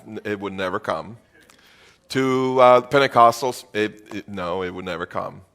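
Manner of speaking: usual speaking voice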